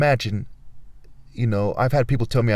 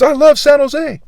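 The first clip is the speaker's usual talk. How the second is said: much higher and louder, sing-song parody; mocking a cheerful vlogger; 12 semitones higher, 7 dB louder than usual. Mocking local vloggers who call the city sweet, sing-song. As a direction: send up a carefree dog walker. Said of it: "While you're making these videos".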